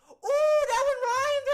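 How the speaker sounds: shrill, loud, squealing tiny elf; leprechaun voice. Retold or imitated. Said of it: "His leprechaun voice".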